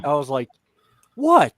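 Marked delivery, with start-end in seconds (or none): 1.1-1.5 high-pitched, puzzled exclamation; retold bafflement. Kid baffled that cousins lost it, mock bewildered. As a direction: squeak it up in mock innocence.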